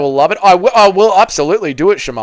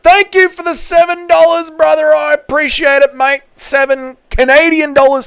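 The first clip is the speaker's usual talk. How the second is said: high voice; 12 semitones higher, 4 dB louder than usual. Pretend. Thanking donor in high voice.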